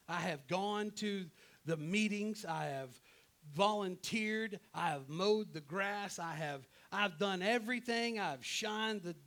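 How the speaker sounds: aggrieved prophet listing his efforts, building steadily; Elijah's self-justifying protest. Acted. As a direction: plead your case to God with growing insistence. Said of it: "What does Elijah say?".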